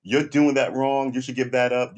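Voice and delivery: mock naysayer laying down the law, loud and slow. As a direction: lecture like a smug critic, slowly. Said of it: "roll up on you and go".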